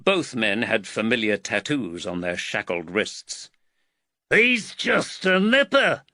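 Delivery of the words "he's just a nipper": slow, high, scornful snap; snapping. A narrator's reading. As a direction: snap it out with contempt. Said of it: "snapped the biggest fellow".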